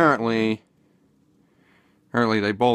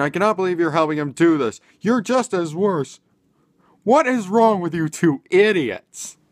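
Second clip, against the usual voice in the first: mock tirade, pitched much higher and a bit louder; imitating furious mom; 8 semitones higher, 3 dB louder than usual. Vlogger imitating the mom yelling at both sons, high mock tirade. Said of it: "she's, like, freaking out".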